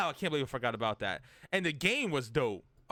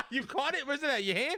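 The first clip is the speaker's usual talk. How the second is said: surprised; 12 semitones higher than usual. Real, not acted. Friend says he caught the bee.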